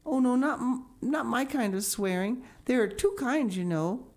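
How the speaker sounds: put-on a young girl eagerly correcting her friend; Anne, earnest. A narrator's reading. Anne explains to Diana.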